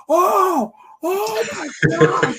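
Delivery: very high, drawn-out fan shriek; re-enacted scream. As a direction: squeal it out in amazed disbelief. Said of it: "I screamed".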